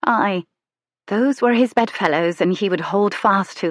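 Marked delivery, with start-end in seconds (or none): none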